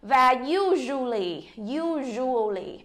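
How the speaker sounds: "usually" slow, stressing the U; slow model word. Shown as a demonstration. Teacher slowly models "usually" for learners. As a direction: draw out "usually", weight on U. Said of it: "Nhấn chỗ u".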